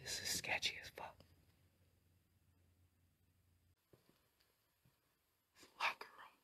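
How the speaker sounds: hushed, very soft whisper; nervous night vlog whisper. Real, not acted. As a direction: whisper it in dread. Whispering in dark.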